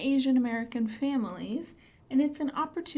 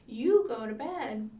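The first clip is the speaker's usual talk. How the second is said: bedtime rhyme; 5 dB louder than usual. Shown as a demonstration. Rhyme repeated.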